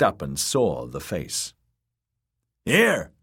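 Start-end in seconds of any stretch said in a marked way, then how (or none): 2.6-3.1 loud, high call; high, teasing shout. A narrator's reading. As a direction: call her attention from above. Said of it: "he called to Brian".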